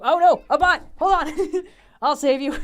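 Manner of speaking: loud, high excited yells; excited shouting. Real, not acted. Streamer shouting at a donation, excited and loud. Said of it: "I was too excited".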